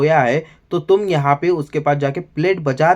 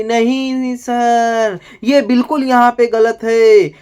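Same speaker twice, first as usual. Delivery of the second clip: mock Kunika protest; 7 semitones higher, 4 dB louder, much slower than usual.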